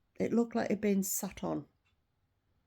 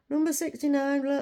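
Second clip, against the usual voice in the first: higher-pitched mimicked order call; staff calling orders; 6 semitones higher, 4 dB louder than usual. Counter worker to waiting customers, mock calling out. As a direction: imitate a worker calling out a number. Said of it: "shouting out the orders".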